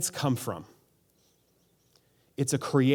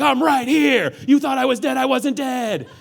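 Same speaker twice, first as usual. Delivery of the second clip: higher and louder, hammed-up heroic return; triumphant knight; 8 semitones higher, 6 dB louder than usual. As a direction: burst out of the rubble like a proud knight. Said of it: "And he's like".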